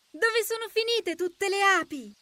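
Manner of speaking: high, soft little ladybird voice; small high-pitched insect voice; ladybird character. Acted. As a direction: pipe it up like a tiny puzzled ladybird.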